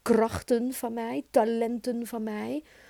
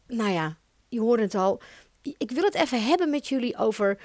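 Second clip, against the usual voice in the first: normal talking voice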